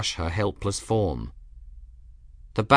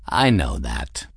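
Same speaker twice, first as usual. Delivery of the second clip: low, slow, self-assured cat; lofty cat; 9 semitones lower than usual. A narrator's reading. Cat accepts the captain's thanks, lofty. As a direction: accept it deep and without modesty.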